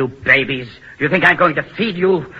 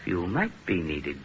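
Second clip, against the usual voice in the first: menacing murmur; 8 semitones lower, 10 dB quieter than usual. Acted. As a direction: let the threat sink in quietly. Repeats it low and menacing.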